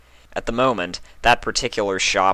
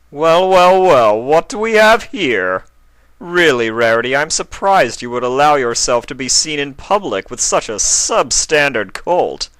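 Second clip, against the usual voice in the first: sneering prince; 7 semitones higher, 7 dB louder than usual. A narrator's reading. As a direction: sneer down at them like a spoiled royal.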